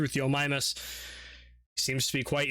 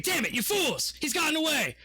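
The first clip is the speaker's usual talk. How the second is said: higher, furious outburst at the guards; angry commander; 13 semitones higher than usual. Acted. Commander yelling at his guards, higher angry outburst. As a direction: snap at the fools, pitched up. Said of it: "He's like".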